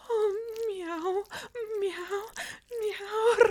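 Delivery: a string of rising cat meows; playful meows. Acted. Catgirl stuck in a window, meowing at her partner's touch. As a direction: meow like a pleased cat, each rising.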